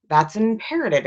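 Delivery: ordinary delivery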